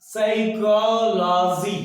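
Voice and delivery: साइकोलॉजी slow and careful as sai-ko-lo-ji, silent p; exact pronunciation. Shown as a demonstration. Teacher giving the exact pronunciation to students. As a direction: model साइकोलॉजी slowly and exactly. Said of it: "exact pronunciation मैं बोल रहा हूं".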